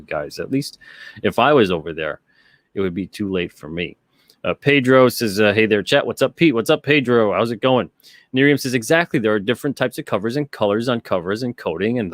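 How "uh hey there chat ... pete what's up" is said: ordinary talk, nothing marked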